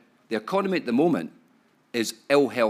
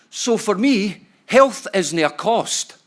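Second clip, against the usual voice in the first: louder and higher, slowing down on the key point; emphatic; 8 semitones higher, 9 dB louder, much slower than usual. Real, not acted. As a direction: hammer home that health isn't a cost. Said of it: "key point of keynote".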